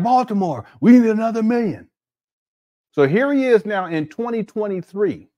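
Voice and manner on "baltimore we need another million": mock preacher. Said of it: "He's like".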